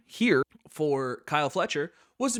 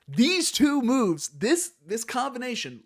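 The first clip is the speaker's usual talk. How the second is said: awed emphasis; 10 semitones higher, 5 dB louder, slower than usual. Real, not acted. Awe at move combo.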